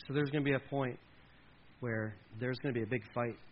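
ordinary talk, nothing marked

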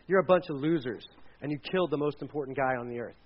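deadpan jab in Peter's voice; lightly voiced Peter; Peter speaking. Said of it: "which isn't the best way to start a speech".

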